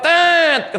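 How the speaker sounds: high, long horn-like toot; drawn-out high beep; child's buzzer reply. Retold or imitated. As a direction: imitate a child's honking answer. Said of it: "Anak yang jawab".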